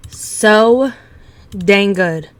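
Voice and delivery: loud gush that peaks on so; thrilled by the recipe, punch so and dang; loud delighted craving; excited. Real, not acted. Excited reaction to a tasty recipe.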